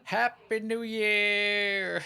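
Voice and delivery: loud slow New Year yell; new year countdown crowd. Real, not acted. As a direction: yell it out high and long. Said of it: "Just yell Happy New Year".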